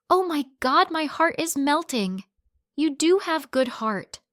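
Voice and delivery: high, sing-song mock sympathy; mocking him. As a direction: fake being touched to ridicule him. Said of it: "I laughed as I mocked him".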